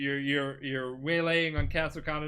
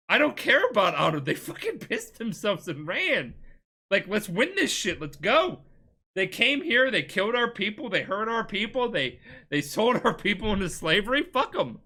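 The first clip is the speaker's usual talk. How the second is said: heated rant; 7 semitones higher than usual. Retold or imitated. Player yelling back at his uncle.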